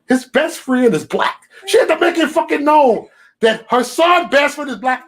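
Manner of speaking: high, loud mocking rant; mock her, then blow up at it; high loud mockery of the woman's words; mocking outrage.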